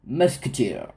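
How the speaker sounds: "Musketeer" in a self-mocking English accent; hammed-up English accent on a gun name. Shown as a demonstration. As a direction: try an English accent on the name, half joking. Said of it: "avec mon accent génial en anglais".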